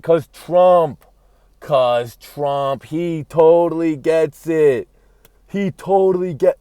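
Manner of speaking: loud, high, excited insistence. Real, not acted. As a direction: hammer home the revelation. Excited realization continues.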